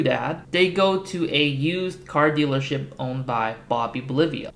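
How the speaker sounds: ordinary delivery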